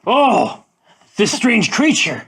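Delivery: high, loud theatrical amazement; hammed-up wonder from a bird playing dumb; feigned astonishment. Jay feigns ignorance of sparrow.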